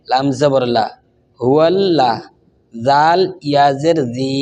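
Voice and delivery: ordinary delivery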